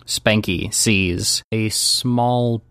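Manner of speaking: low, slow scene-setting voice; game master narration. Acted. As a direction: reveal a glimpse slowly and low.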